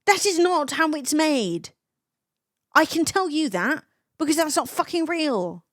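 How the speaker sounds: high, emphatic, exasperated correction; annoyed. Real, not acted. Streamer debunking fake AI thumbnails, annoyed and emphatic. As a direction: spell it out in irritation. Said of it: "Annoying me to the nth degree".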